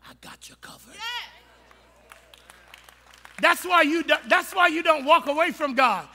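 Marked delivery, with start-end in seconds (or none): 0.1-0.9 soft breathy whisper, barely voiced; a tender secret assurance; whispered reassurance. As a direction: lean in and promise it quietly. God reassures the believer.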